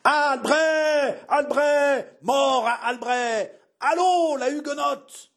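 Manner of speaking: high, frenzied crowd chant; vociferating mob. A narrator's reading. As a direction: cry for blood like an angry mob. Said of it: "gesticulant et vociférant". (vociférations de foule haineuse)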